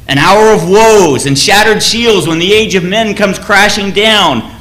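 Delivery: a king steeling his men before battle; a solemn war cry before the gates of the evil empire; rousing speech. Retold or imitated. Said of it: "gives this rousing speech to the men".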